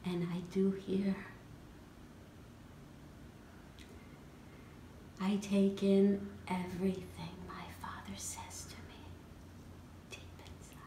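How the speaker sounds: soft narration fading to a whisper; hushed storytelling. A narrator's reading. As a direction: let it sink almost to silence. Intimate narration of listening to father.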